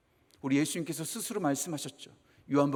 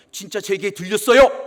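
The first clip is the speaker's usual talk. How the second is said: mock scolding; 3 semitones higher, 12 dB louder than usual. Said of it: "이렇게 막 혼내시더라고요".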